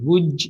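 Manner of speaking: "Would" as "wudge"; "Would" ready to join a following "you"; linked J form. Shown as a demonstration. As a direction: close "Would" with a J sound. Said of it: "is right when you have you afterwards".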